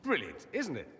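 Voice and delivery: ordinary talk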